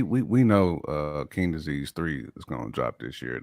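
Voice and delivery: normal talking voice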